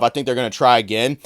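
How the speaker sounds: usual speaking voice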